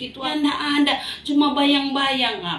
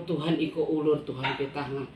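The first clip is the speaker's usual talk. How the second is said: ordinary talk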